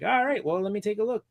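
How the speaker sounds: a hint of a slick announcer; a touch of cheery radio host on a support call; Ryan Seacrest voice. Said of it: "my Ryan Seacrest voice".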